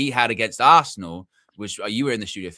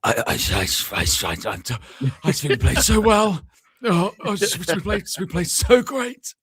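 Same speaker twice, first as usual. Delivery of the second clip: slow, stammering put-on voice going higher; imitating D J; 8 semitones higher, 5 dB louder, slower than usual. Mimicking D J in the post-match talk, slow and higher. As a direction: drag it out like a dazed player. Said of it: "D J was like".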